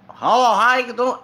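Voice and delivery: loud, high Texan greeting. Retold or imitated. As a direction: imitate a Texan principal's big hello. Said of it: "That's how he talk".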